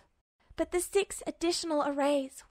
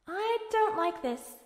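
higher, slow worried voice; uneasy; 6 semitones higher than usual. A narrator's reading. Alphonse tells his brother his worry, slow and uneasy. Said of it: "Alphonse looked at the door".

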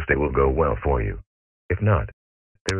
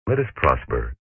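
usual speaking voice

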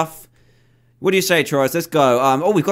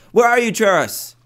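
calling out; 6 semitones higher than usual. Real, not acted. Calling out for missing companion.

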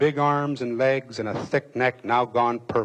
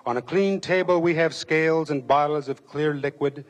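ordinary delivery

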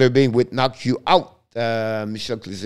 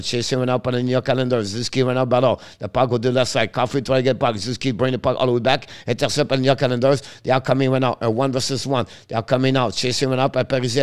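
usual speaking voice

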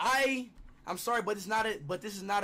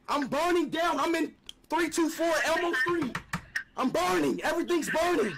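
raised, hammed-up cry of distress; mock anguished yelling; 4 semitones higher, 3 dB louder than usual. Prank caller reporting fake fire, mock anguished yelling. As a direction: wail it out in fake agony.

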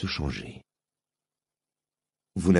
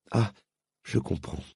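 much quieter, gentle understanding; girl understanding his fear; softened; 6 dB quieter than usual. Acted. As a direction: drop the anger, suddenly tender. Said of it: "changeant soudain de ton".

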